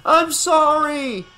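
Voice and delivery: high, drawn-out apologetic cry. A narrator's reading. Father on the dock apologizing to daughter, high cry. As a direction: wail the apology across water. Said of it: "he cried".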